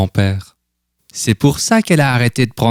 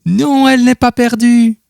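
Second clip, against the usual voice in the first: much higher, louder character voice; grandfather's voice; 4 dB louder than usual. A narrator's reading. Grandfather revealing the missing photo, high and louder. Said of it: "dit grand-père".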